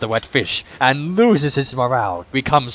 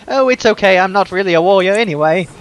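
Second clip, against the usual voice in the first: put-on higher, quick girl's voice; cheerful girl; 7 semitones higher, 5 dB louder than usual. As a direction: brush it off like a bright child.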